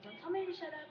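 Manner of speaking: high-pitched imitation of scolding parent; imitating a cross parent; mock parental yell. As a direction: snap back as an offended parent, half-yelled. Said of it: "and then they yell at me".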